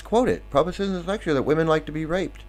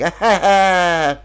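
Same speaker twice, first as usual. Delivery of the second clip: loud, drawn-out fake laugh; slow exaggerated "ha ha" of a cartoon figure; mock laughter; 4 semitones higher, 8 dB louder, much slower than usual. Two of Brahma's heads laughing, hammed-up guffaw. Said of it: "these two are laughing".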